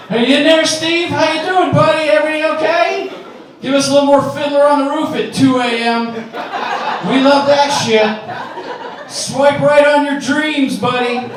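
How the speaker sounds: high, sing-song fake concern through the door; mock cheery neighbor. Neighbor calling through the wall to singing Steve, high mock cheer. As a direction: call in to the lonely neighbor, hammed-up sweet.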